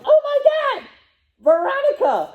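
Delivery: a giddy shriek of remembering; sudden excited squeal. Real, not acted. Suddenly remembers Veronica, laughing.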